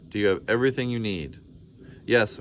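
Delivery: ordinary delivery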